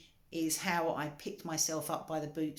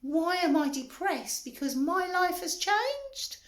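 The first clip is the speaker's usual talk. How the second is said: indignant; 11 semitones higher, 6 dB louder than usual. Retold or imitated. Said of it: "I went".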